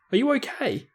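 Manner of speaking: high, urgent question. Acted. Harry checking on Ron after the crash, urgent. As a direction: ask fast and worried. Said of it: "Harry said urgently".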